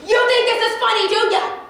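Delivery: high, loud sarcastic yell; mock yelling adult. Kid imitating a sarcastic parent yelling, high and loud. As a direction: scream it like an angry parent. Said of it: "when they say".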